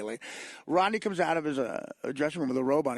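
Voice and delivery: ordinary talk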